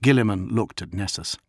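ordinary delivery, nothing marked

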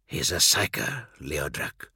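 low, quiet hiss. Acted. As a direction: hiss the warning under your breath. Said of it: "He hissed".

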